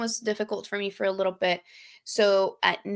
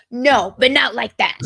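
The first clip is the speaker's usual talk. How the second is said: mock retort; 3 semitones higher, 9 dB louder than usual. Arguing with boyfriend.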